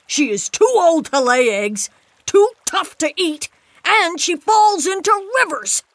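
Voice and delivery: loud, high-pitched tirade, slowing for the verdict; angry shout. A narrator's reading. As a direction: shout out your list of complaints, then draw out the final order. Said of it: "Pa shouted".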